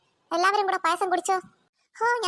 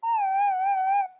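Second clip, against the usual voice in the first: high fake wailing; shrill mock crying like a cricket; whining cry; 4 dB louder than usual. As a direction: wail like a sulking child. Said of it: "ഇവളുടെ മോങ്ങലാ".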